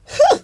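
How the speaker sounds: sharp high-pitched huh; mock huh. Real, not acted. Vlogger driving home the setup claim with a high huh. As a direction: yelp a knowing huh. Mocking exclamation after claim.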